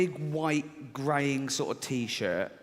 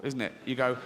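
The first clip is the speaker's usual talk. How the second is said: usual speaking voice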